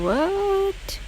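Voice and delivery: normal talking voice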